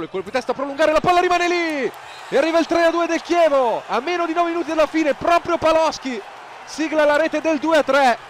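climbs high and loud; building goal call. Real, not acted. (telecronaca del gol in crescendo)

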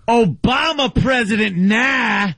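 high, sneering taunt imitation; mocking heckler. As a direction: mimic the heckler's jeer. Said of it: "as he yelled to me".

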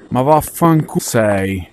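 ordinary talk

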